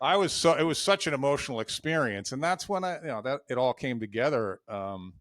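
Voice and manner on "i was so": laughing. Real, not acted. Laughing after near swear.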